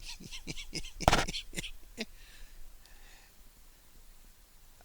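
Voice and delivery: long airy laugh climbing very high; real laughter at a joke from chat; long helpless laugh. Real, not acted. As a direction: laugh hard and long, almost squeaking.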